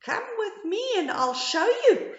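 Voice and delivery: high frog voice. A narrator's reading. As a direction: invite them like a frog. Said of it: "replied the frog".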